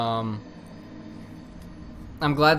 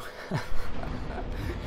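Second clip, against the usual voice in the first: soundless airy giggle; breathless wheeze laugh; 6 dB quieter than usual. Real, not acted. Co-host stifling laughter, breathless wheeze.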